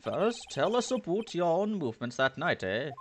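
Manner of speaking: high-pitched, put-on courtroom voice; courtroom figure. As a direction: preside in a high character voice.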